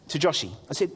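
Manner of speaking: ordinary delivery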